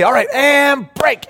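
high, drawn-out huddle call; huddle break call. Retold or imitated. Nerdy kid breaking the huddle, calling it out high and slow.

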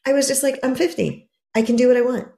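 normal talking voice

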